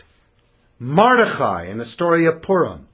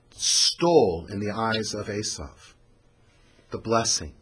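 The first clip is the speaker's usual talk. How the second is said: usual speaking voice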